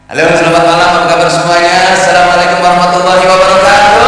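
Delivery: high, ringing stage shout with a long final note; pop singer greeting. Shown as a demonstration. Preacher opening a sermon in a singer's style, high and sustained. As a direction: hail the crowd like a singer, stretching the ending upward. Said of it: "pakai gayanya penyanyi".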